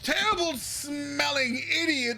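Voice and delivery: high-pitched, rambling complaint from a fussy noble; pompous noble rant. Acted. Noble complaining to the guard about his caravan, in a high fussy voice. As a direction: whine about your ruined caravan.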